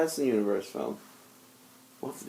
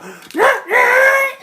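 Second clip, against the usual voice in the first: very high loud squawk; bird screech; 8 dB louder than usual. Retold or imitated. Imitating the bird's noise.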